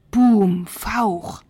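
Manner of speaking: loud boom, then a huffed hiss; imitated dragon noises. A narrator's reading. Narrator imitating the unseen dragon, booming and hissing. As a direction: boom it out like a storyteller's sound effect. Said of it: "machte es unzählige Male".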